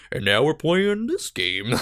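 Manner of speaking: slow, flat-faced announcer voice; deadpan self-impression. To viewers, mocking her own stoic streaming, slow deadpan. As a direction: say it like someone with a stoic face. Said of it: "just like".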